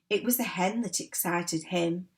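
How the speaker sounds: ordinary delivery, nothing marked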